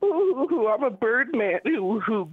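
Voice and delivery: mocking impression. Caller imitates the host to mock him. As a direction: drag it out in a whiny mock. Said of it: "here's an example of what you sound like".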